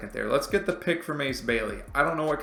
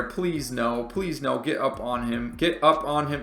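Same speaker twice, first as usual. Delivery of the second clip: ordinary talk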